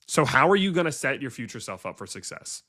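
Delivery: normal talking voice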